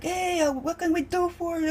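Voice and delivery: high, bright-toned imitation of a Boston shopkeeper's greeting; Boston accent. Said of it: "'cause they have a Boston accent".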